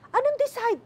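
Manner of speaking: high, alarmed questions in retold panic; panicked. Niece on the phone hearing bad news, panicking high. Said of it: "nagpapanik na siya".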